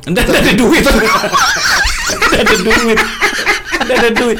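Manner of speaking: high, loud, helpless laughing; tertawa lepas. Real, not acted. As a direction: blurt it out through real laughter. Menertawakan alasan tak kena narkoba. (bicara sambil tertawa terbahak-bahak)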